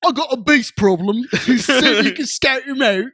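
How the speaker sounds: loud Cockney voice breaking into laughs; laughing through a loud Cockney accent. Acted. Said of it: "Do your Cockney accent".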